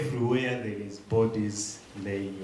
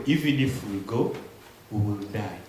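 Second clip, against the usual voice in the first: normal talking voice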